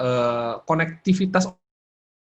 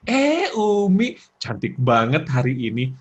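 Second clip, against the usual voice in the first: complimenting husband; 3 dB louder, slower than usual. Acted. Husband praising his wife's looks, exaggerated compliment. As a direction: gush like an admiring husband. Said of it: "Kata suami kita".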